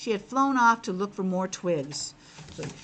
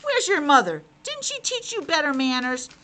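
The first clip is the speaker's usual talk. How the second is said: louder, higher frog voice scolding; 5 semitones higher, 5 dB louder than usual. Acted. Frog scolds the piglet about his manners. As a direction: tell him off like a strict frog. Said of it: "frog scolds piglet".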